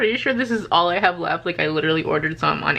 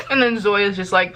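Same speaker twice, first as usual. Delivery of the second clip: normal talking voice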